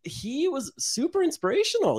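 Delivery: usual speaking voice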